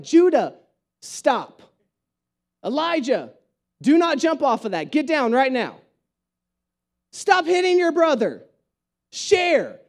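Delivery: mock parental commands, firmly spaced out; firm, exasperated telling-off, one order at a time; stern parent. Parent to misbehaving kids at home, stern.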